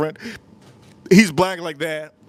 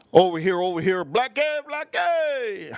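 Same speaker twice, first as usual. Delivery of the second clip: catcall; 6 semitones higher, slower than usual. Retold or imitated. Guys calling out at the beach.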